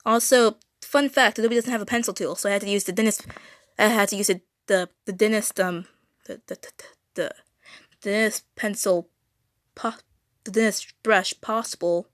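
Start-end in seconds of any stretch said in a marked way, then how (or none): 3.8-10.0 stumbling over and repeating words; stuttering. Real, not acted. Live streamer tripping over words while explaining a brush, real stuttering. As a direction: stall and restart on little words. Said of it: "Sorry for my stuttering".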